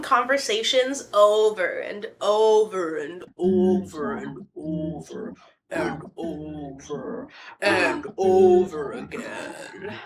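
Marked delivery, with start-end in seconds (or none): 1.8-9.9 low, very slow, drawn-out repetition; slow-motion effect. Pretend. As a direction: drag each word out like slowed tape. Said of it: "do some cool effect there".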